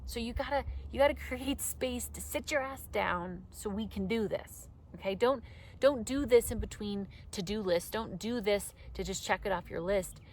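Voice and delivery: ordinary talk, nothing marked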